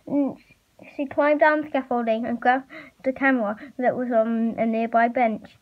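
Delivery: ordinary delivery, nothing marked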